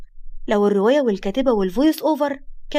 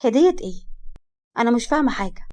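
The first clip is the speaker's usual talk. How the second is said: feigned confusion. Acted. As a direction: pretend not to know about the gift. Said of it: "وهي بتتصنع عدم الفهم". (تظاهر خفيف بعدم الفهم)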